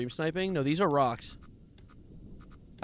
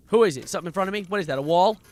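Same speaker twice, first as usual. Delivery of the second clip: loud, high, spooked questions; jumpy alarm; 7 semitones higher, 11 dB louder, faster than usual. Real, not acted. Streamer spooked by something ahead in the dark, loud alarm. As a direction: call out nervously at the unknown thing. Startled by obstacle.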